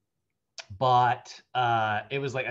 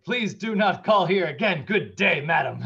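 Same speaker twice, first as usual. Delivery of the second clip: louder, higher overly polite farewell; a prim, grand goodbye; mock formal dad; 5 semitones higher, 7 dB louder than usual. Dad dismisses the caller, in a louder mock genteel tone. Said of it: "he's like".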